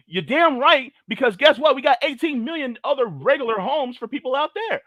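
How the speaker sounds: high, loud, emphatic rant; heated retort. Real, not acted. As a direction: fire back hard with pitch way up. Heated retort to imagined critics.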